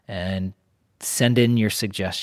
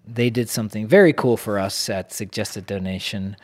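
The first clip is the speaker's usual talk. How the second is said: ordinary talk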